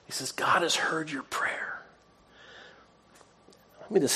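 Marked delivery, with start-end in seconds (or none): 0.3-1.8 slow, hushed whisper; awed whisper. Acted. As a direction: breathe the news like a sacred secret. Angel delivers the message.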